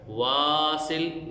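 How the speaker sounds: वासिल with the first vowel long; long alif. Shown as a demonstration. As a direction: stretch the वा of वासिल. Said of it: "इसको लंबा इसलिए कर रहे हैं". (अलिफ मद्दा लंबा)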